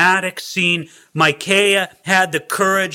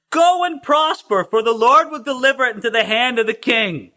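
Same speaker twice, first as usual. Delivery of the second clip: mocking proclamation; 7 semitones higher than usual. Prophet answering the king, grand mock blessing. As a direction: proclaim it grandly, starting high then coming down. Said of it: "everybody knew that he was mocking him".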